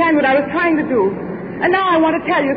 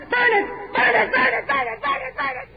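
frantic cry fading; 6 semitones higher, 3 dB quieter than usual. Acted. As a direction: cry out, then fading away.

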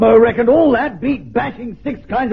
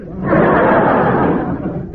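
hearty laugh; 6 semitones higher than usual. Acted. Admiral laughs at the weather office.

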